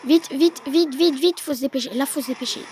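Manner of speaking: loud, rapid vite vite vite; urgent hurry. Real, not acted. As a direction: push them to hurry. Rushing to defend tower. (vite, vite, pressé et fort)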